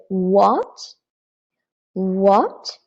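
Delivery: WHAT sliding sharply up; surprised question. Shown as a demonstration. Teacher likening second tone to English surprise, rising surprised WHAT. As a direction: ask WHAT with a surprised rise. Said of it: "when you are so surprised".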